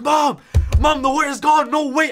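high, loud yell of amazement; freaking out. Retold or imitated. Kid telling mom the wart is gone, freaking out. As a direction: re-enact an ecstatic kid calling out. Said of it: "I'm freaking, like".

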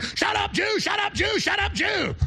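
high mock yell, repeated; mock heckler. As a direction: chant it like a jeering mob. Imitating hecklers yelling at him.

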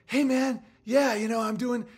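high, sugary friendly greeting; smiley nice guy. Retold or imitated. Imitating the smiley guy greeting him, high and sweet. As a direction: play the overly nice smiling rich guy. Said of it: "so nice and so smiley".

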